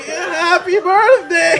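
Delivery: high, slow, stretched birthday wish; exaggerated birthday cheer. Handing the wine to a stranger, with stretched cheer. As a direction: sing it out with forced cheer.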